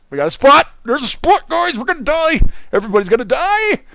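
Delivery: playful Minecraft panic yell; panicked yell. Real, not acted. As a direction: shriek that everyone's doomed. Panicked yelling at mobs.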